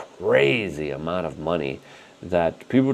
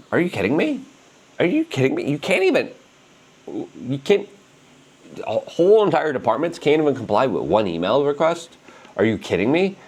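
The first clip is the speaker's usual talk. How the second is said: incredulous; 7 semitones higher than usual. Retold or imitated. Outraged at agencies refusing email.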